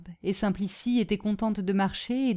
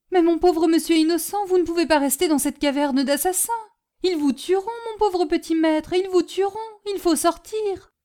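high, loud, urgent pleading; fretful servant, pitched high; alarmed, devoted servant; 11 semitones higher, 7 dB louder than usual. Acted. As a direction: plead with the young master in alarm.

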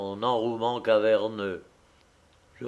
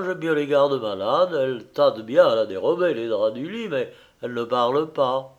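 ordinary delivery, nothing marked

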